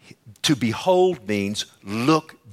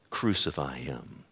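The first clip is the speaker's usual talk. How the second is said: low and much softer "Crucify him"; hushed; 9 semitones lower, 8 dB quieter than usual. Shown as a demonstration. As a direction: let "Crucify him" drop to a low hush. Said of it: "Not one voice for him".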